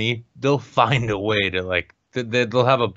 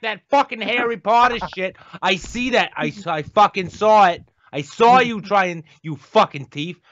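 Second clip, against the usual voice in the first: put-on much higher, louder, brash Boston accent; Dorchester Boston accent; 8 semitones higher, 3 dB louder than usual. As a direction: scold the kid as a Dorchester librarian in a thick Boston accent. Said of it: "maybe I'm thinking more Dorchester".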